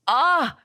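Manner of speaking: short, high "ah" yelp; pained cry. A narrator's reading. Grabbed at sore shoulder, acted pained yelp. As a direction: cry out briefly in acted pain.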